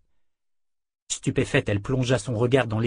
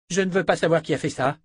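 quick, higher, firm statement; firm refusal; 7 semitones higher, 3 dB louder, faster than usual. A narrator's reading. Callan addresses the magicians after the collapse, firm refusal. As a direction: shut the matter down quickly. Queen refuses to learn culprit.

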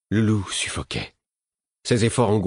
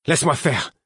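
loud, high desperate plea; pleading cry; 8 semitones higher, 4 dB louder than usual. A narrator's reading. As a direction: cry out, begging him to let you help. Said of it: "lui criai-je d'un ton suppliant".

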